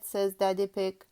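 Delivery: usual speaking voice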